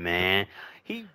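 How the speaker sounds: normal talking voice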